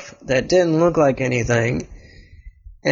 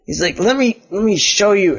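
mildly frustrated; 6 semitones higher than usual. Acted. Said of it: "making Elliot frustrated".

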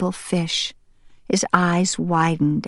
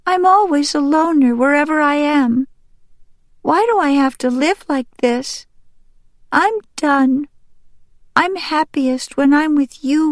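put-on higher-pitched, plaintive young duck; upset young duck Green Top; 8 semitones higher, 3 dB louder than usual. Young duck tells his mom he's always a loner, plaintive. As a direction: complain to mother in a high child-character voice.

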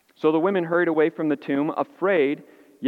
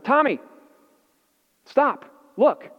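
put-on loud, higher urgent call to a panicking boy; urgent shout; 9 semitones higher, 4 dB louder, slower than usual. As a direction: shout to cut through his screaming. Dad yelling at panicking son.

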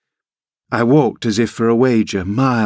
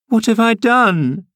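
put-on much higher, slow, a hurt girl near tears; a scolded child protesting; sobbing girl; 13 semitones higher than usual. A narrator's reading. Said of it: "sobbed she".

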